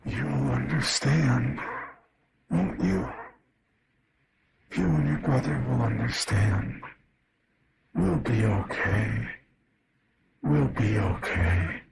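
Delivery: slow, distorted voice like a dying tape; slowed broken tape. Acted. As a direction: drag the words like a warped recording. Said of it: "full of static and slowed down like a broken tape".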